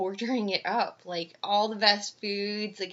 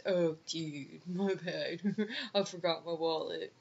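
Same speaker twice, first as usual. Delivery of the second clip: low, soft mock-sheepish dude; badly faked surprise at a missing wallet; mumbled, put-on excuse, imitating the date; fake apology; 3 semitones lower, 7 dB quieter than usual. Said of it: "and it was so fake".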